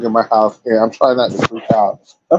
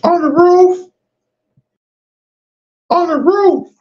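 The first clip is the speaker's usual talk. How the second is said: calling out. Real, not acted. Calling out bug location.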